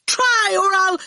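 high, threatening yell; angry yell. A narrator's reading. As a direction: yell a threat at full pitch. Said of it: "Torquil yelled at him".

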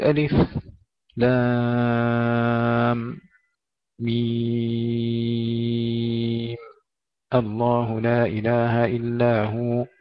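ألف، لام، ميم each apart, ميم hugely drawn out; breathless short pauses. Shown as a demonstration. Teacher demonstrating a reading for students, halting chanted ألف، لام، ميم. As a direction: chant slowly, brief silent stop after ألف، لام، ميم. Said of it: "يسكت سكتة خفيفة بلا تنفس".

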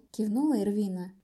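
ordinary delivery, nothing marked

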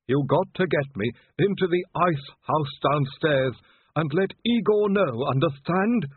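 slow, high voice of a wounded servant; Igor. A narrator's reading. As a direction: press urgent last instructions on the master, laboriously. Dying Igor gives instructions.

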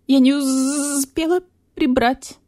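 the beetle apologising nervously; timid beetle voice. A narrator's reading. Zhuzha explains.

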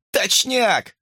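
high, pleased cry; glad exclamation. A narrator's reading. Hamster confirming the cars are there, gladly exclaiming. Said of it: "обрадовался хомяк".

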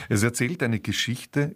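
ordinary talk

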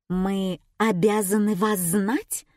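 ordinary delivery

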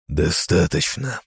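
slow, cold hissed word; icy hiss. Acted. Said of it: "прошипел я ледяным тоном".

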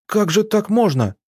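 high, indignant plea; protesting wail. A narrator's reading. Arina pleading against the banishment, protesting. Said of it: "протестующе завопила она".

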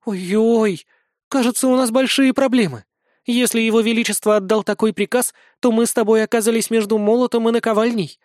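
a bride realizing trouble; alarmed young woman. Acted. Alina alarmed.

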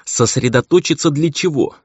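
high, agitated demand; inward cry. A narrator's reading. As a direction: want to cry it out at him. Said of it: "хотелось крикнуть ей".

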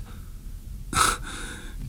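a quiet unvoiced chuckle; soft breathy laugh. A narrator's reading. Host laughs off the guest's doubts, soft chuckle. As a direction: laugh it off under your breath.

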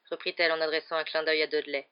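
ordinary delivery

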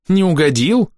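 high-pitched incredulous echo; rising, derisive repeat; scoffing. A narrator's reading. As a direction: throw the question back with a scoff. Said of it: "Хейли усмехнулась".

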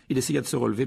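usual speaking voice

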